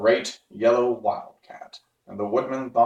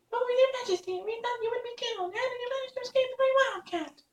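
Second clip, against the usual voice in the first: much higher, fast tiny squeaks; worried mice; 4 dB quieter, faster than usual. Acted. Mice exclaim to their Queen, fast high squeaks. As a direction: rush it out like frightened little mice. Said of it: "they exclaimed".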